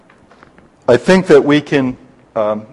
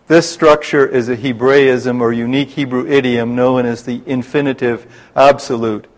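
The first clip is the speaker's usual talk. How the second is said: normal talking voice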